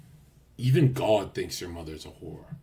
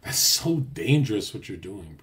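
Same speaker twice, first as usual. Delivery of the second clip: ordinary delivery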